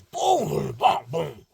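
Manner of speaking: loud punchy booms that fade in force; energy booms. Shown as a demonstration. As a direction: punch each boom out, then let it fade. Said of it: "Your energy go like this".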